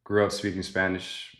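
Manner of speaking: normal talking voice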